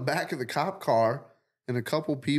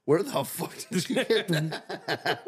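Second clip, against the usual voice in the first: laughing; 5 semitones higher, slower than usual. Retold or imitated. Quoting own shock in dream.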